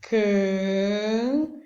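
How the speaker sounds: "Can" held long on its own; separated syllable. Shown as a demonstration. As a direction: stretch "Can" into one slow, drawn-out syllable. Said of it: "Você vai separar a sílaba".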